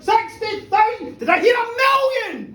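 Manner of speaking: high, loud excited bid call; auctioneer's shout. Acted. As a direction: whip up the bidding at full voice. Auctioneer pushes for million.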